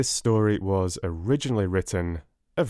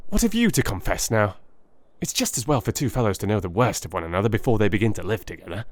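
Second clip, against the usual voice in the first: Holmes voiced; 3 semitones higher, faster than usual. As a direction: play Holmes listing his own faults, quick at the end. Holmes confessing his faults.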